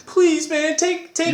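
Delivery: pleading crying. Retold or imitated. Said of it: "You were, you were crying".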